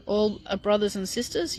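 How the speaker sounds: ordinary delivery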